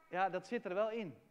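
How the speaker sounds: ordinary talk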